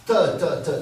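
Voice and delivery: "te" struck loud and high off the teeth; explosive T. Shown as a demonstration. Speaker demonstrating plosives on the teeth, percussive "te". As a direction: fire each "te" as a hard dental burst. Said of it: "c'est sur les dents".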